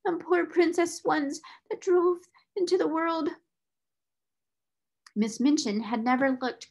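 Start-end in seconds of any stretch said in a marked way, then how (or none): 0.0-3.3 high-pitched, sorrowful cry; wailing. A narrator's reading. Maid weeping for the fallen princess, wailing. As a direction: lament it plaintively, pitch well up. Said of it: "she wailed".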